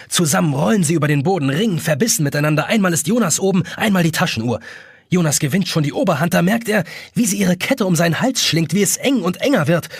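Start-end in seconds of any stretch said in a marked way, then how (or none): none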